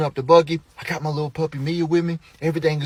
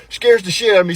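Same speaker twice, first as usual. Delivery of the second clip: usual speaking voice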